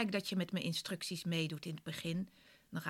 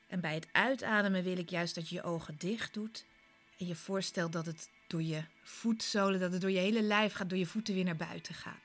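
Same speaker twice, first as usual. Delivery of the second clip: guided meditation. Acted. Leads a guided relaxation.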